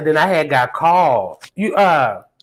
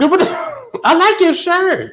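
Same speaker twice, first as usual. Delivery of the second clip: delighted surprise. Real, not acted. Host noticing guest's shirt, high delighted surprise.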